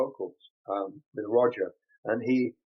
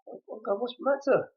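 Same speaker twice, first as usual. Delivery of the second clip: mock alarm; 11 semitones higher than usual. Retells alarm at crying fans.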